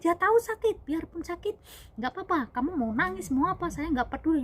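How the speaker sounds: high-pitched, emphatic overruling; impatient insisting. Retold or imitated. Father figure overruling her pleas before massage, impatient insisting. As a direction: imitate an exasperated father figure.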